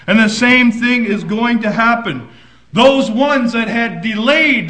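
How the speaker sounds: loud, driving preacher's voice; fiery preaching. Acted. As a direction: proclaim it to the church. Preaching at full force.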